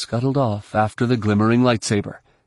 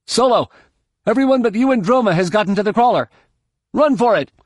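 high-pitched shouted warning; raised, high, pressing voice; urgent call; 11 semitones higher, 4 dB louder, faster than usual. A narrator's reading. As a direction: call urgently over a comlink. Urgent comlink call during the fight.

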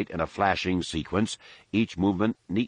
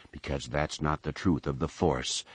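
usual speaking voice

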